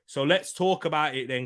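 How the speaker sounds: usual speaking voice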